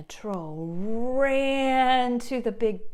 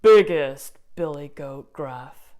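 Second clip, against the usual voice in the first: louder, lower big goat voice; big billy goat; 5 semitones lower, 7 dB louder, slower than usual. Acted. Storyteller voicing the big goat, loud and low. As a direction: boom it out like the biggest goat.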